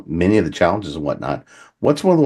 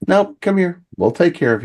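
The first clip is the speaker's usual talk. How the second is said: kindly Appalachian woman; 12 semitones higher than usual. Retold or imitated. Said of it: "this is an old Appalachian woman".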